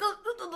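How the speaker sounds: high startled little noises; imitated baby gasps. Baby in car seat hit by rain, startled gasps. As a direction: mimic a baby flinching at raindrops. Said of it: "when the rain started hitting him".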